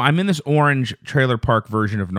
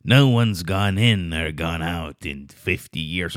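put-on low, eerie old-movie warning; put-on low voice of foreboding; ominous cart man; 5 semitones lower than usual. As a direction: intone it darkly, like a cryptic stranger. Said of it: "the guy rolls up with that super noisy cart".

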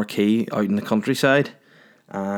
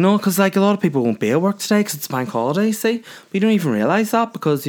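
excited; 9 semitones higher, faster than usual. Acted. Mock arguing with listeners.

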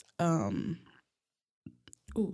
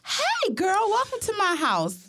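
customer service voice; 10 semitones higher than usual. Retold or imitated. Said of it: "You gotta be like".

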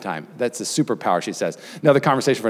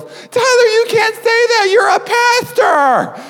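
much higher, slow, yelled scolding; brother yelling; 4 dB louder, much slower than usual. Retold or imitated. Brother yelling at him in front of the girls, high slow scolding. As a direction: yell it across the boat like a shocked brother. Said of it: "my brother yelling".

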